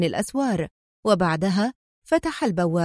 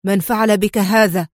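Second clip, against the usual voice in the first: louder, firm interrogation; stern; 6 dB louder than usual. A narrator's reading. Boss questioning his battered man, stern and louder. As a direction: demand it sternly. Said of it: "سأله أوكونور في صرامة".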